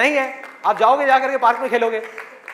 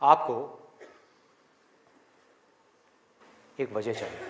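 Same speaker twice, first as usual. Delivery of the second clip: guided imagining; 6 semitones lower than usual. Acted. Guides listeners through imagined desert. (धीमी, गहरी, शांत आवाज़ में कल्पना कराते हुए)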